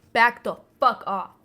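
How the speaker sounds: loud possessive snap; playful loud back-off; mock threat. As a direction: warn them off like a jealous girlfriend. Jealous mock threat over Mothman.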